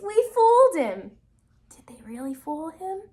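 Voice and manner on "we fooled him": high, loud triumphant cry; gleeful. Acted. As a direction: crow about the trick. Pigs gloating over the wolf.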